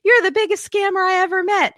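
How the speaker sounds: high, loud imitation of her outburst; mock her flying off the handle; set-off outburst. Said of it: "sets her off, and she's like".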